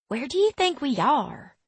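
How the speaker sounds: high-pitched Southern twang; Southern accent. A narrator's reading. Girl wonders where they are, high Southern accent. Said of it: "her voice twangy with a southern accent".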